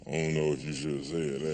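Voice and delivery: low, soft second thoughts from the whiskey; hushed, low voice of regret; Jack Daniels doubting. Acted. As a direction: back off quietly in a deep-pitched voice. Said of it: "that's when that Jack Daniels said".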